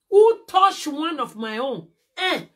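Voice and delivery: slow and loud, daring anyone; defiant loud challenge. Real, not acted. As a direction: challenge them slowly at full volume.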